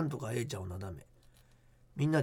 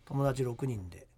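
hushed creepy ghost story; 3 semitones lower than usual. Acted. Reads listener ghost letter.

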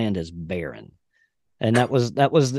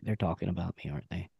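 much softer, fading paranoid murmur; anxious inner voice; 4 semitones lower, 12 dB quieter, faster than usual. Retold or imitated. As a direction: say it low to yourself as if you're afraid they hear. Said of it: "I always was like".

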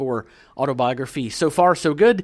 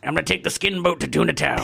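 put-on comic old-codger voice; grumpy old man; 3 semitones higher than usual. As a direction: boast like a randy old codger. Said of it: "had him swear a lot".

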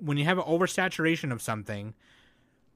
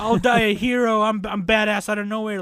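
loud, high-pitched mock heroics; mock badass hero; 7 semitones higher, 7 dB louder than usual. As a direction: ham up the doomed hero. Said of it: "he's acting all badass".

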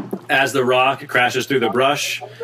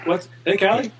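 calling out; 7 semitones higher than usual. Acted. NPC calls out to player.

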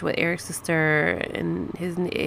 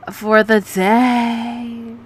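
long, dragged-out mock reply; mocking drawl; 3 semitones higher, much slower than usual. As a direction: stretch the final word way out.

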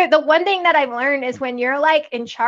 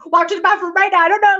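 higher, rising flustered command; urgent insistence; 6 semitones higher, 4 dB louder, faster than usual. Retold or imitated. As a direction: hustle a child away, acting out mild panic. Acted urgent order, repeated more insistently.

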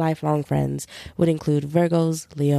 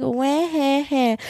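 mock crying; 8 semitones higher, much slower than usual. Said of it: "the Pisces of just, like".

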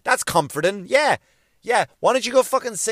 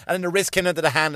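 ordinary talk

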